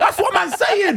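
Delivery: high, slow, loud drawn-out exclamation; hammed-up road talk through laughter; slipping into road talk. Back with old road friends, slipping into road talk. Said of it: "We slipped right back in".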